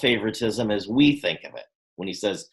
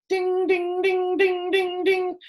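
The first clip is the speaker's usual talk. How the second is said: high singsong ding ding; bell ding; slower than usual. Retold or imitated. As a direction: chime it like a bell. Imitating a bell, idea clicks.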